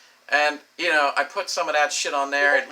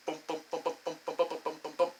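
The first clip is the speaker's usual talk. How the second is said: soft, repeated mouth-made booms; little muted thuds, over and over; imitated bumping; 3 semitones higher, 8 dB quieter, much faster than usual. Storyteller miming futile banging like Sinistar, soft imitated bumping. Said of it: "I'm just banging into this".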